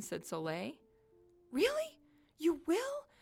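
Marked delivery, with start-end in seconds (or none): 1.5-3.1 very high, louder squeaks of disbelief; amazed, hopeful Yeti. Acted. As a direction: ask it in joyful astonishment. Lonely Yeti offered friendship, jumps happily.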